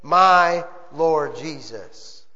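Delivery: "My" leaned on, slow and low; slowed emphasis on My; stress on MY. Shown as a demonstration. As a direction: drag "My Lord Jesus" out slowly, hitting My. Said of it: "And I emphasize here".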